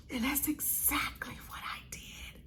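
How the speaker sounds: hushed dramatic whisper; confiding whisper. Real, not acted. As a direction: whisper it like a juicy confession. Confiding after the acted quote.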